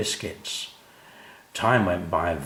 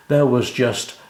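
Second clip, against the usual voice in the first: usual speaking voice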